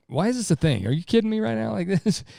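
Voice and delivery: can't believe this is even an argument; incredulous exasperation. Retold or imitated. Husband fed up with a trivial argument, incredulous.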